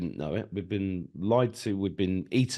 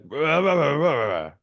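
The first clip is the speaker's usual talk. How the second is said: tax officer nagging; 6 semitones higher, 8 dB louder than usual. Retold or imitated. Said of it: "And she went".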